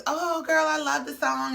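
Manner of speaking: put-on high-pitched girlish gush over the song. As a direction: gush like a fan meeting a star. Quoting Destiny gushing over song.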